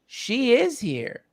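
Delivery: slow hushed certainty; the teacher gently reassures his grieving guest; slow, gentle quote. Retold or imitated. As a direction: say it slowly, tenderly, as a simple truth. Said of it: "And he goes".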